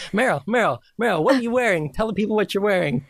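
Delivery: high-pitched, eager questioning; red-carpet interviewer. Pretend. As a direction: press the star for an answer like an excited reporter. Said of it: "I'm gonna be interviewing".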